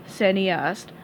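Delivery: usual speaking voice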